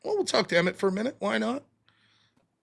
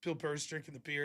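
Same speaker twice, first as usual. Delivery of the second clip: ordinary talk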